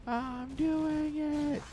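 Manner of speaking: very high, slow squeaky voice. Pretend. Caster replaying his line in a squeaky voice. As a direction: squeak it out like a cartoon.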